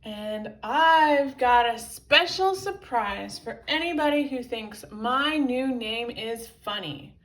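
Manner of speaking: loud, heavily stressed threat; furious. A narrator's reading. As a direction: threaten them loudly, leaning on the words. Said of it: "said the furious professor".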